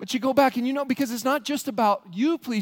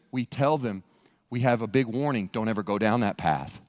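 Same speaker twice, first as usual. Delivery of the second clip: spooky guide; 11 semitones lower than usual. Acted. Guide warning the pilgrim about the dark path, ominous. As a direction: sound like a guide at a haunted trail.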